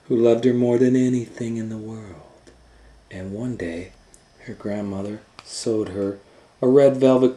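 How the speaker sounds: loud, bright read-aloud of a fairy tale; storybook narration. A narrator's reading. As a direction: tell it big to a room of kids. Reads fairy tale aloud loudly.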